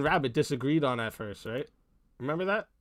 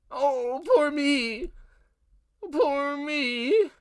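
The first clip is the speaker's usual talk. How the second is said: mock self-pity; 10 semitones higher, much slower than usual. Mocking people who want pity.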